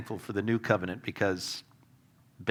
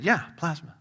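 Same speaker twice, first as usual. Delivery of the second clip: a light imitation of a thick Irish accent, dry; lightly put-on Irish brogue for the friend; playing the Irish friend deadpan, in a hinted Irish accent; mock thick Irish accent; 3 semitones higher than usual. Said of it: "he had a very thick accent".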